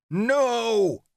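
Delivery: high, loud roaring no; furious scream. A narrator's reading. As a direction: scream it as you lunge. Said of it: "Samson's scream echoed".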